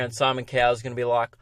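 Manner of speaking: ordinary delivery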